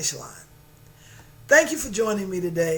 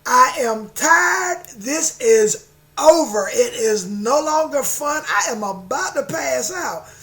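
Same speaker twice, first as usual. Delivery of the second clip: exasperated insistence; 4 semitones higher, 6 dB louder than usual. Retold or imitated. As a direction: insist you're exhausted, louder. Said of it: "she said".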